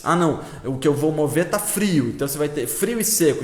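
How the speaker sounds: ordinary talk, nothing marked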